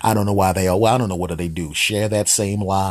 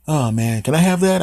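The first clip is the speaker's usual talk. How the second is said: rising high, louder pleading; eager dog; 9 semitones higher, 5 dB louder than usual. Acted. Dog asking the man for the cat's phone, eager high voice. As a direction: beg for it as an excited cartoon dog.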